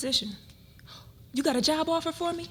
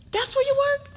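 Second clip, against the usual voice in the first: surprised; 14 semitones higher, 4 dB louder than usual. Acted. Surprised he works there.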